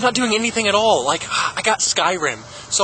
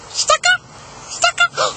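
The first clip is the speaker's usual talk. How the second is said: very high squeaky mock. Squeaky mocking voice.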